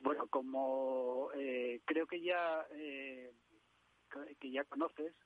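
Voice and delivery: distant, faint voice on the phone; speaking away from the receiver; phone held away. Real, not acted. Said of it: "te escucho un poquito lejos".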